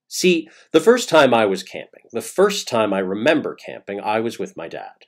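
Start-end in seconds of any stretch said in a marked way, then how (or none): none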